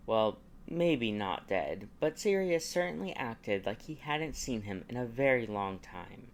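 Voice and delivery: usual speaking voice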